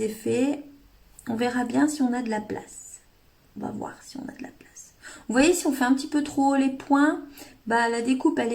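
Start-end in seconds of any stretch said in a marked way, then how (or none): 3.6-4.9 a soft whispered aside; whispered repeat under the breath. Shown as a demonstration. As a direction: whisper it to yourself, much softer.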